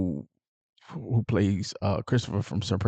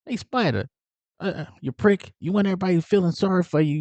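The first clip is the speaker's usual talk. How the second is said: higher-pitched mock needling; going at him; 9 semitones higher than usual. Tommy needling Spider over sympathy, high mock scorn. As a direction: lay into him in a raised, imitated voice. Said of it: "just going at him".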